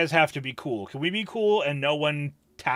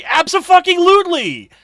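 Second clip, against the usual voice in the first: emphatic shout; 13 semitones higher, 8 dB louder than usual. Real, not acted. Emphatic agreement.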